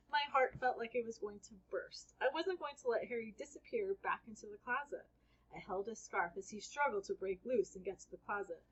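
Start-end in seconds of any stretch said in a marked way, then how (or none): none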